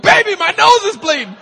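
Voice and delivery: high, loud frantic plea; shrill panicked cry to his wife; panicked whine. Retold or imitated. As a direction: beg your wife for help in a panic.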